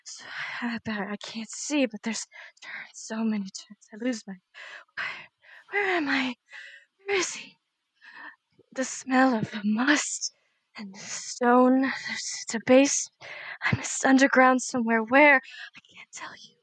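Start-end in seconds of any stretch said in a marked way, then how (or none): 0.1-11.8 disoriented hush. Acted. Psychic vision, disoriented whispering. 15.4-16.6 hushed whisper, lost in a vision. Acted. Medium in a trance whispers what she cannot say.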